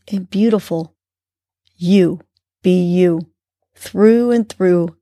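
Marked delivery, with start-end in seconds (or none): none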